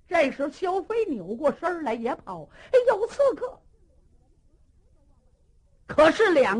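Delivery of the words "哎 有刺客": very high, loud cry; alarmed shout. Acted. Xiao Fei fleeing and shouting there is an assassin. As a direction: yell the alarm in panic.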